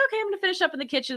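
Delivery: rushed high quote; fast. Retold or imitated. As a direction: rattle it off quickly.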